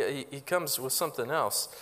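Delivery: ordinary delivery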